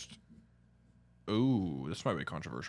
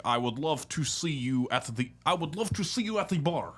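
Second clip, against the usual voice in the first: higher mock Russian woman; Russian accent; 7 semitones higher, 4 dB louder than usual. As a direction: invite him out for vodka, heavily accented. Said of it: "Russian's pretty bad".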